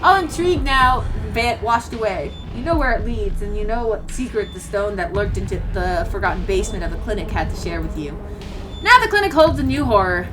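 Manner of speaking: low, soft ominous narration; story narration. A narrator's reading. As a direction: narrate it darkly. Reads scene narration.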